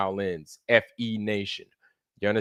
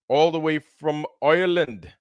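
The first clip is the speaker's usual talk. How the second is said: badly faked Irish lilt, pitched up a little; terrible Irish accent; 4 semitones higher than usual. Greeting a viewer from Ireland in a terrible Irish accent. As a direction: attempt a hammy Irish accent on the place name. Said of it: "that was a terrible Irish, uh, ch-, uh, accent".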